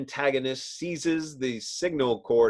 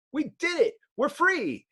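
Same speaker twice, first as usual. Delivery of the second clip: much higher, elated cry; triumphant cheer; 12 semitones higher than usual. Acted. Heroes cheering after sealing the tunnel, elated. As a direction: celebrate the escape.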